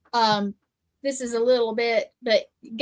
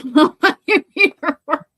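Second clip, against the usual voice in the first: laughing mid-sentence, louder; 3 semitones higher, 8 dB louder than usual. Real, not acted. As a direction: chuckle through the line. Laughs at own confession.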